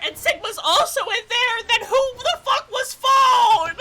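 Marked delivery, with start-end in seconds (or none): 1.7-3.8 high, loud laughing disbelief; laughing shout. Real, not acted. Gamer confused by the twist, laughing shout.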